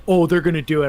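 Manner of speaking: loud, confident exclamation; mock certainty. Fan recalls the reaction they didn't have, loudly certain. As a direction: blurt it out as a sure thing. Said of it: "it wasn't like".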